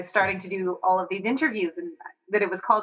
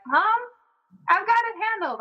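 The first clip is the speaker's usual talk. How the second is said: put-on high, bright kid voice reassuring mom; child reassuring mom; 12 semitones higher, 3 dB louder than usual. Kids reassuring their worried mom, in a high, louder voice. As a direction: pitch it way up like a confident kid. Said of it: "And they're like".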